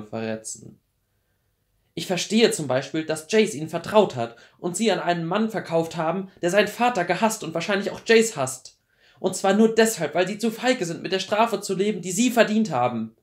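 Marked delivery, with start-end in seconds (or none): 1.9-13.1 high, loud, building anger; furious accusation. Acted. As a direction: lash out at his cowardice. Clary accuses Hodge of betrayal.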